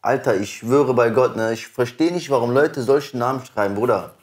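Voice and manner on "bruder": ordinary delivery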